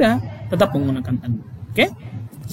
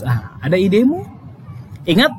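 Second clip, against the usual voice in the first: usual speaking voice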